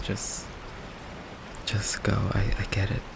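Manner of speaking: very soft, almost whispered; quiet resignation. Acted. Vampire telling a date to just go, quiet resignation. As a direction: give up softly, barely voiced. Giving up, sends date away.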